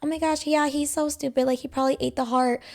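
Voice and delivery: high, dismissive mockery; imitated laughing it off. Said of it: "like, laughing it off".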